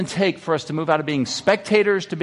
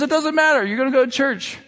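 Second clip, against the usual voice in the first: high-pitched acted mom overruling her son; mock motherly exasperation; insistent mother; 10 semitones higher than usual. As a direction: lay down the law as mom, pitched up. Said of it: "She goes".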